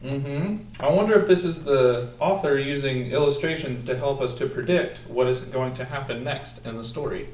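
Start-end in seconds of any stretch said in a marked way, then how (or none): none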